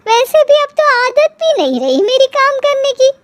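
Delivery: very high, sing-song complaint; whining excuse. Acted. Mother telling daughter she is unused to work, whining. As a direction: plead out of it in a high whine.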